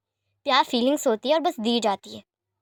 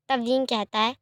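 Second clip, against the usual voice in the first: ordinary delivery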